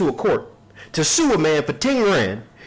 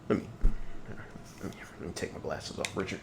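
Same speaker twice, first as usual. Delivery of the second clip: mock whisper; 11 semitones lower, 14 dB quieter, much faster than usual. Mock heart-to-heart with Richard.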